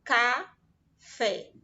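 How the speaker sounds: "Café" said loud as ca-fé, one mouth opening per syllable; syllables shown. Shown as a demonstration. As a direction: open the mouth twice for "Café". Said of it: "a Pro abriu a boca duas vezes pra falar a palavra café". ("Café" falado em duas sílabas, bem marcado)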